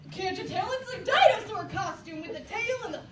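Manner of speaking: mock dinosaur roar to unimpressed kids; defensive excitement. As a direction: insist it's a dinosaur, then roar. Defending dinosaur costume, roaring.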